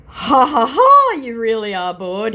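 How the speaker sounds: high, loud, slow fake laugh, then a sneer; mock scammer laugh. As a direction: laugh him off like a smug troll. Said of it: "he said".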